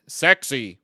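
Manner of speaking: loud pretend verdict; mock-judge pronouncement; girlfriend playing judge. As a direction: land it like a gavel strike. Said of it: "and gavelled".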